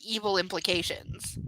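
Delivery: ordinary delivery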